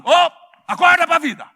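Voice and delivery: high, loud shouted rebuke; wake-up shout. Acted. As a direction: yell it at the parents to wake them. Preacher shouting at careless parents. (grito de alerta aos pais)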